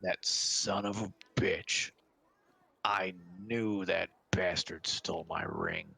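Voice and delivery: slow, grudging half-orc; half-orc cursing slowly under a grievance. Acted. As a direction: draw each word out in annoyance. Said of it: "He's a half orc".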